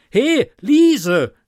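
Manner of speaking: high, loud call to the maid. Acted. Innkeeper shouts for the maid to bring a bottle, loud call. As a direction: shout for Liese across the inn. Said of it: "rief ich".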